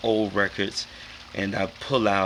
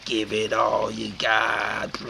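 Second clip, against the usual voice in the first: slow robotic vocoder delivery; much slower than usual. Shown as a demonstration. Trying his little vocoder, robotic. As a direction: say it like a vocoder, slowly. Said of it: "I started going".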